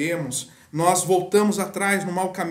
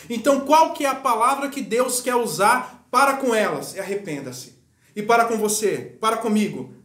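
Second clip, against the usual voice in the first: higher, louder preaching, pressing the point; fervent preaching; 6 semitones higher, 3 dB louder than usual. Acted. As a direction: preach it out over the congregation. Preacher rising to full force. (pregação fervorosa, mais alta e mais forte)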